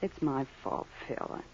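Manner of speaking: hushed apology. Acted. Wife upset, apologizing.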